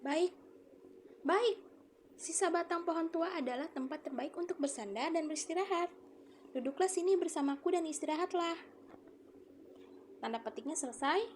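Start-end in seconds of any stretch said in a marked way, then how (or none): 0.1-8.5 put-on an old tree stump welcoming the tired boy; quoted speech. Shown as a demonstration. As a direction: invite him to rest. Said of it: "Seperti ini nih, di kata baik".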